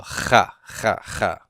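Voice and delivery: "cha" with a throaty, scraping constricted H; guttural H. Shown as a demonstration. Teacher showing students the dotted H, guttural "cha". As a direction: say "cha" like you're spitting from the throat. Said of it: "there's constriction in the throat there".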